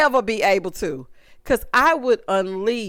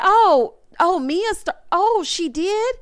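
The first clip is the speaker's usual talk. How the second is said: high-pitched, halting "oh" of mock discovery; high, drawn-out disbelieving question; clueless surprise; 7 semitones higher, slower than usual. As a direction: imitate someone only now catching on. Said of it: "you don't know what's going on".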